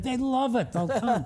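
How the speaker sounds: high-pitched, retold reassurance; breezy comeback. Answering worried campaign people about the delegates, high breezy comeback. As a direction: wave the objection away, pitched well up.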